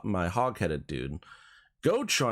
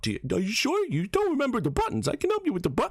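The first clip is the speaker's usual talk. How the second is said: fussy dad voice; 13 semitones higher, faster than usual. Retold or imitated. Dad voiced in a retold argument.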